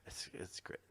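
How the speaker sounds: whispered aside; soft whispered agreement. Real, not acted. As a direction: breathe it out under the talk. Aside while others chat.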